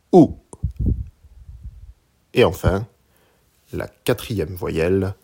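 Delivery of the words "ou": OU short, loud, falling from high; fourth tone on OU. Shown as a demonstration. Teacher showing learners Mandarin OU, falling tone. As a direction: drop OU sharply from the top. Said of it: "Une dernière fois".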